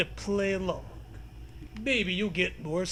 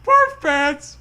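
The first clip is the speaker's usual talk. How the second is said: loud goofy yell; loud high comic outburst; silly shout; 8 semitones higher, 11 dB louder than usual. Real, not acted. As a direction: blurt it at full volume.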